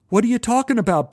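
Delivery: high, fast, alarmed question; cried out. Retold or imitated. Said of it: "Barry cried".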